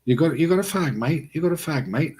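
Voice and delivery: casual British "mate", lightly imitated; British slang imitation. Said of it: "as I learned in the U K".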